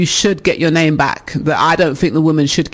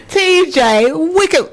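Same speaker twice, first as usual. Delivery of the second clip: mock outrage; 12 semitones higher, much slower than usual. Real, not acted. Host echoing a listener's insult, mock offended. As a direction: pretend to be wounded.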